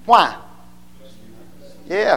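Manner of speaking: ordinary talk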